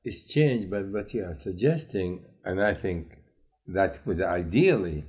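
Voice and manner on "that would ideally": ideally said slowly and louder, leaning on it; slow and loud, weighing the word "ideally"; stress on ideally. Real, not acted. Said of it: "and I emphasize the word ideally here".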